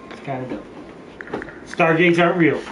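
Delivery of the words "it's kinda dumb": slow breathy whisper. Real, not acted. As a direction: whisper it under your breath. Whispered repeat.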